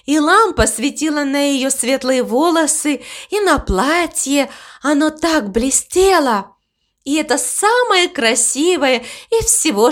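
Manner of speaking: high, lilting voice of an enthusiastic cow; dreamy cow. Acted. Cow telling the crow about the girl dancer, dreamy delight.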